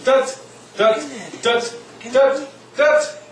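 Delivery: staccato dots; short detached dots. Shown as a demonstration. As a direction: tap out each dot short and climbing. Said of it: "like a dot".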